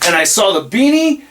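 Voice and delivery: normal talking voice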